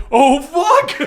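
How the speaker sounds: high laughing yelp; mock Nightwing panic. Game master mocking Nightwing fleeing, high laughing yelp. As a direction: squeak it out mid-laugh. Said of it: "Nightwing is like".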